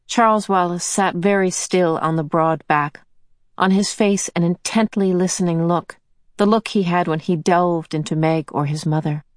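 ordinary delivery, nothing marked

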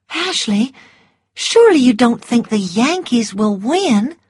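high, anxious plea; pleading Scarlett. A narrator's reading. Scarlett begging Ashley to deny it, high and anxious. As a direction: beg him to say no.

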